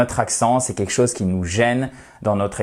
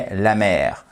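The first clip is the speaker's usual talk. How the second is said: usual speaking voice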